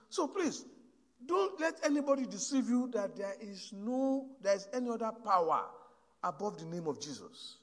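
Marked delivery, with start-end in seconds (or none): none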